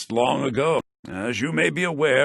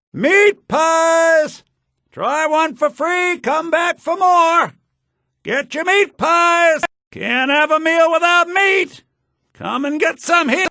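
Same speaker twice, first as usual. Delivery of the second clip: loud, much higher hawker's shout; vendor calling out; 8 dB louder, slower than usual. Acted. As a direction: cry your wares across the market. Vendor hawking pies at festival.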